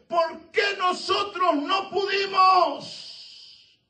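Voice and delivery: high, very slow pleading shout; anguished shout. Acted. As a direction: drag out the question in anguish. Disciples asking Jesus. (grito suplicante, agudo y muy lento)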